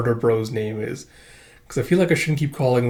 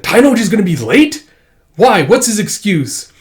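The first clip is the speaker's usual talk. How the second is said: irritated demand; 8 semitones higher, 7 dB louder than usual. Acted. Chocho demanding an excuse.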